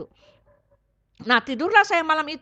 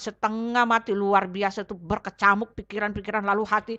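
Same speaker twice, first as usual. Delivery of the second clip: ordinary talk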